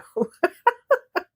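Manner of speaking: high, giddy laugh. Real, not acted. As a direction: laugh like you're blushing. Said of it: "I think I'm blushing".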